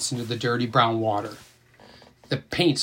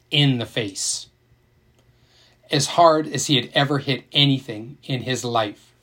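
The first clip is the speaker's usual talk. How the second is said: ordinary delivery, nothing marked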